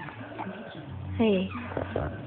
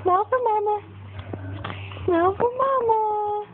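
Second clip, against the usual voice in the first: much higher coaxing sing-song; 7 semitones higher than usual. Real, not acted. Mother coaxing baby to smile, high baby talk.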